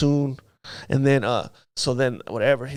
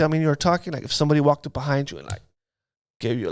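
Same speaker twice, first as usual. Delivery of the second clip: normal talking voice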